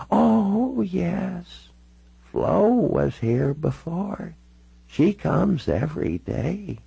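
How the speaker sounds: put-on high, very slow elderly voice; frail old lady dwelling on each word; very old woman. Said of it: "She looked all of ninety-six years".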